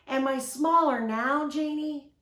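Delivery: high, loud call with a questioning lift; boy hollering across a distance; calling from afar. A narrator's reading. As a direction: shout it from even farther down the beach. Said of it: "he called".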